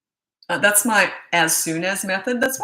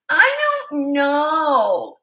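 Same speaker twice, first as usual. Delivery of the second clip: very slow, high, louder drawn-out whine; mock whine; 9 semitones higher, 6 dB louder, much slower than usual. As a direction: mirror the kid's whining back at her. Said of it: "That's how you sound".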